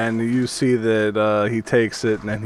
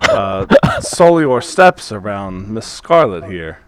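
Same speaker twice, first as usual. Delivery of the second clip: obsequious merchant grovelling to a customer, louder at times; fawning shopkeeper; 8 dB louder than usual. Acted. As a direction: grovel with exaggerated apology. GM voices fawning Pauling.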